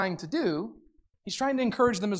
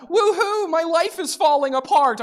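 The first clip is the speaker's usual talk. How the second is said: high, loud fake cheer; hammed-up celebration of disaster; mock cheer; 11 semitones higher, 6 dB louder than usual. Pastor mocks fake joy for the congregation, high and loud. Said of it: "this is not a".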